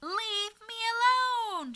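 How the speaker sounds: very high, loud, slow furious yell; shouted. Acted. Old woman screaming at the moon men, very high and loud. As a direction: scream it at the top of the lungs. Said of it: "shouted the old woman".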